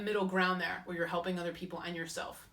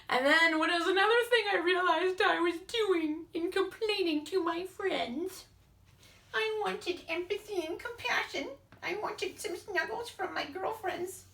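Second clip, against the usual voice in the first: put-on whiny baby voice; 12 semitones higher, 4 dB louder, slower than usual. Mocking her needy self.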